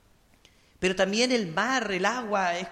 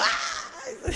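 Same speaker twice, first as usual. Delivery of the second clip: high, drawn-out retold scream with a laugh; laughing mock shriek. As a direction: relive the fright with a long high cry, half laughing. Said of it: "Grité".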